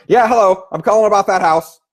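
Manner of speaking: loud, high, brash pitch; match the caller's loudness. Shown as a demonstration. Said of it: "So if that's the case, I go".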